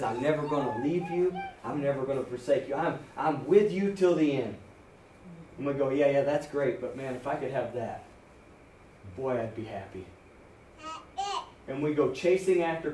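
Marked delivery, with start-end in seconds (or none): 0.0-4.6 much softer, gentle reassuring voice; whisper-close, like a loving promise; tender promise. Retold or imitated. Preacher voicing God to the listener, soft tender promise. 5.9-10.1 much softer, offhand brush-off; dismissive aside. Retold or imitated. Preacher voicing a believer ignoring God, soft brush-off.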